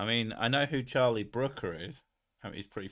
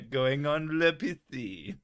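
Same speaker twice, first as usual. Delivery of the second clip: mock French accent; 4 semitones higher, slower than usual. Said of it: "Missus Sick with a French accent".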